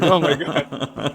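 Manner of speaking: drawn-out mock exasperation, half laughing; slow teasing "oh my God". Wife teasing her husband about crying, slow amused drawl. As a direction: stretch it out, gently teasing. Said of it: "she's sort of almost teases me".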